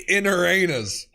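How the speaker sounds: ordinary talk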